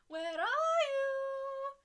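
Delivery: high, slow, loud sing-song call; sing-song seeker. Acted. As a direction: call like a drunk hide-and-seek player. Drunk Romans searching for them.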